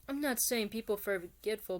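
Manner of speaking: usual speaking voice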